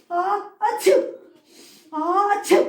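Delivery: loud high mock sneezes; fake sneezes. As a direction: sneeze twice for the class. Teacher fakes allergy sneezes. (estornudos fingidos)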